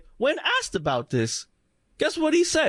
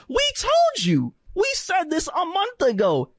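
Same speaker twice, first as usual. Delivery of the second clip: gloating high; 10 semitones higher than usual. Real, not acted. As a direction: rub it in from up high. Gloating at the audience.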